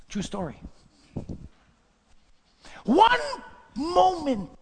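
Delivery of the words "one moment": high, drawn-out exclamation; dramatic preaching cry. Real, not acted. Preacher driving home a fallen pastor's story, dramatic cry. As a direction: let each word ring out high, then pause.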